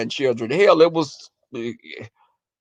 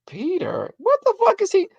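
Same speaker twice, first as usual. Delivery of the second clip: baffled reaction; 12 semitones higher than usual. Retold or imitated. Grandchild asked by boyfriend about Peter, baffled.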